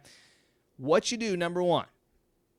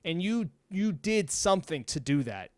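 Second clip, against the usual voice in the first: normal talking voice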